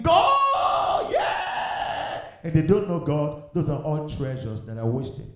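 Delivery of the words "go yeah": crowd cheer. Retold or imitated. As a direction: whoop like a crazed sports fan in the arena. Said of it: "They are dancing and shouting".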